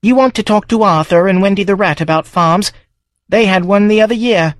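a pub landlady chatting over the bar; Brenda the barmaid. Acted.

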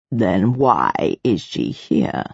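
very low, drawn-out, measured question; deep, deliberate authority figure; cold interrogator. A narrator's reading. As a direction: ask slowly from deep in the voice. Stern interrogator's voice in narrated fiction.

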